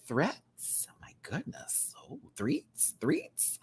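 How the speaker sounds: hushed, breathy disbelief; shocked whisper. Real, not acted. Streamer whispers in shock reading a super chat name. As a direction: whisper it like you can't believe it.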